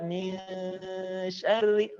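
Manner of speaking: "min" hummed long before "syarri"; held gunnah. Shown as a demonstration. Teacher models the second verse, held "min". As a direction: hold the n of "min" in the nose. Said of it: "tahan di rongga hidung".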